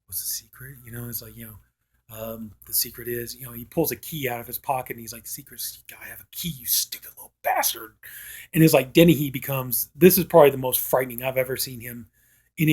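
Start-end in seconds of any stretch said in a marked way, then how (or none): 0.0-0.8 hushed taunting echo. Retold or imitated. Killer echoing his captive's question, hushed and taunting. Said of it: "he's all like". 5.3-8.0 hushed menacing taunt. Retold or imitated. Killer revealing the key to his captive, hushed menacing taunt.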